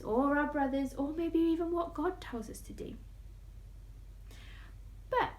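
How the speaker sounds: ordinary delivery, nothing marked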